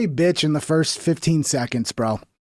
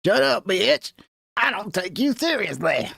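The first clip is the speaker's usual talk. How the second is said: a hint of a mountain hillbilly accent, slower; mock hillbilly; 3 semitones higher, slower than usual. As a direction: drawl it like a mountain hillbilly, lightly. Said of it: "sounding like a hillbilly from the mountains".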